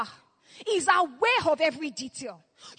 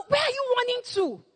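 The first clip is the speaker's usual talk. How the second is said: higher, pressing question; raised urgent question; 7 semitones higher than usual. Acted. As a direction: demand to know where they run. Repeated question, first take higher.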